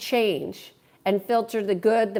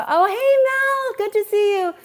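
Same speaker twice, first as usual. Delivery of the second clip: high, louder happy hello; delighted greeting; 11 semitones higher, 4 dB louder than usual. Real, not acted. Streamer spotting Mel in the chat, delighted high greeting. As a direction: call out a delighted welcome, pitched way up.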